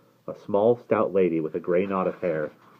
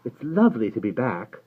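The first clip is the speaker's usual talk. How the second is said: higher, politely pitched greeting; diplomatic; 5 semitones higher, 3 dB louder than usual. A narrator's reading. Jane greeting her hosts on being back, diplomatically. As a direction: say it with careful tact. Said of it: "Jane said diplomatically".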